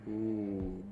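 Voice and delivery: normal talking voice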